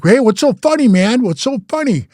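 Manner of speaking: loud, high, confronting challenge; challenging. Retold or imitated. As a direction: demand an answer from the guys laughing. Confronting laughing attackers.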